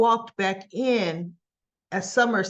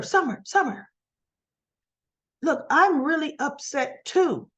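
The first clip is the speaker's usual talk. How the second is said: higher, urgent appeal; acted calling after; 5 semitones higher than usual. Kyle following Summer out, acted urgent calling. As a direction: call after someone to get their attention.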